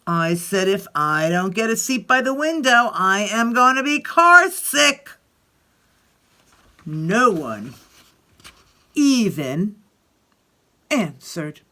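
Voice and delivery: put-on lively read-aloud voice for children; expressive picture-book reading; story narration. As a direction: tell it like Alexander grumbling through his day.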